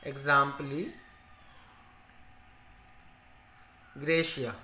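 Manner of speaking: "Exempli", long pause, "Gratia", each word set apart; slow dictation. Shown as a demonstration. Teacher repeating the full form for students, slow dictation. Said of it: "क्या होती है?".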